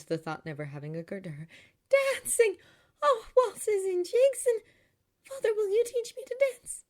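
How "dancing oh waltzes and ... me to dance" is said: very high, loud, excited outburst; thrilled. A narrator's reading. Girl begs father to teach her dancing, thrilled. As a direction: burst out "Dancing" louder and higher, delighted.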